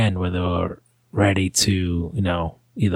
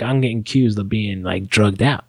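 ordinary talk

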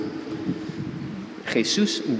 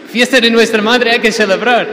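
much higher and louder, bursting with festive joy; celebración entusiasta; 10 semitones higher, 8 dB louder than usual. Retold or imitated. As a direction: exclaim it like someone who can't hold back the party. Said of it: "no podía resistir pensar". (exclamación alegre, mucho más aguda y fuerte)

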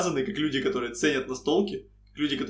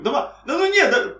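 put-on whiny kid; 11 semitones higher, 5 dB louder than usual. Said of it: "kid protesting".

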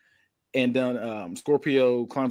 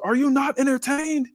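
much higher mock yell at the crowd; 13 semitones higher, 4 dB louder than usual. Wrestler hyped up, yelling at the crowd, mock yell. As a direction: hype the crowd up with a playful yell. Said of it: "he yells".

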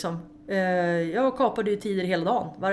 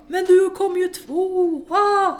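excited news; 11 semitones higher, 5 dB louder, much slower than usual. Retold or imitated. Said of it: "och de bara".

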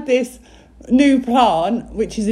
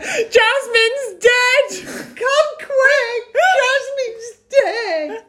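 very high, drawn-out alarm call; mock panic; 12 semitones higher, much slower than usual. Calling Mum in from the garden, high mock panic. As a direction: stretch it out as a hammed-up emergency. Re-enacting alarm over a fallen plant.

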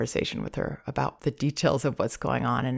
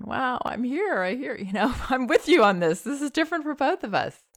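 high soothing voice; 9 semitones higher than usual. Shown as a demonstration. Parent lying beside crying baby, high and soothing. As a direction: reassure the baby from close by.

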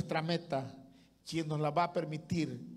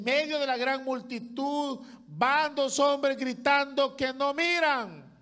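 rising, louder preaching build; 7 semitones higher, 6 dB louder, slower than usual. Acted. Preacher telling the congregation of the blind men, rising louder. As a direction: lift the voice as the cry swells.

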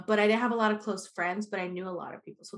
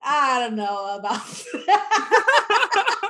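laughing; 3 semitones higher, 8 dB louder, much slower than usual. Real, not acted. Laughing reply.

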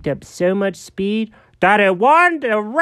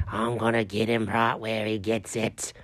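dark other voice; 7 semitones lower, 11 dB quieter than usual. Acted. Jenkins turned dark, threatening Chuck, low menacing. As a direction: growl it from a dark place. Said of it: "that's my voice".

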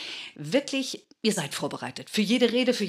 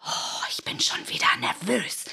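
nervous whisper. Shown as a demonstration. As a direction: whisper „Oh, ich bin schon wieder nervös“ anxiously, almost no voice. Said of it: "wenn ich das sage". (geflüstert, ängstlich: „Oh, ich bin schon wieder nervös“)

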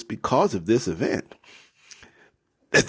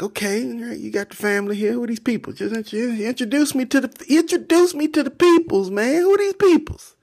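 excited Esau; 14 semitones higher than usual. Acted. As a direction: clamour for an introduction, pitched way up. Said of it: "Esau's like".

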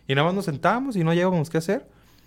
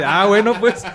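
laughing through a higher, louder mock reply; imitación entre risas; 7 semitones higher, 7 dB louder than usual. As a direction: mimic her meek "ok" while cracking up. Imita a la señora riéndose. (imitando a la señora, agudo y fuerte entre risas)

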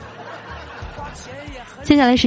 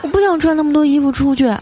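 high, put-on pouty voice; whining wife; 6 semitones higher than usual. Wife refuses to go out in the cold, whiny. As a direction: act a spoiled wife whining at her husband.

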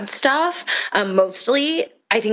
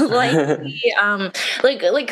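ordinary delivery

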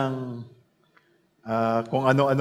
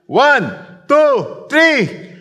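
rising shouted count, higher each number; loud count; 13 semitones higher, 8 dB louder, much slower than usual. Real, not acted. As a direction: count them in at full voice. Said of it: "Ready na kayo?". (malakas na pagbilang)

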